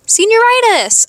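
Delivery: high-pitched burst of remembering; sudden excited exclamation. Real, not acted. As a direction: blurt it out high and drawn out, topic just remembered.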